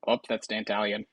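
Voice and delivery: usual speaking voice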